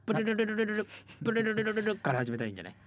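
プルルルルルルル with a rolled trill. Shown as a demonstration. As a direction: roll the r in プルルルルルルル. (巻き舌のプルルルルルル)

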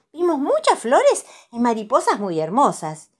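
put-on high, bright little-girl delight; Pepa's voice. A narrator's reading. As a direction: gush like an excited little piglet. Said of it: "dice Pepa".

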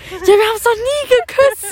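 high, loud mock-aggressive complaint; aggressive drunk outburst. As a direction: imitate a drunk friend protesting loudly and slowly. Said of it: "So richtig aggressiv vor allen Dingen noch". (aggressiv nachgemachte betrunkene Beschwerde)

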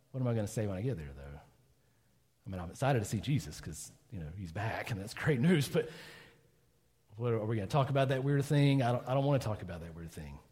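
mumbled inner worry with pauses; uneasy thinking aloud. Acted. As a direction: trail into reluctant self-talk. Peter dreads meeting Jesus.

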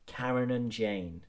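ordinary talk, nothing marked

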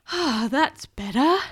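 drawn-out storybook relief; relieved sigh. Acted. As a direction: let it out with relief. Girl relieved after releasing the gas.

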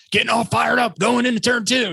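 high, excited race announcer call; Bagman impression. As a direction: call the turn at a high, fired-up pitch. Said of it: "and hear him".

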